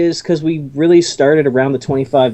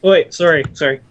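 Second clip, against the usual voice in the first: ordinary talk, nothing marked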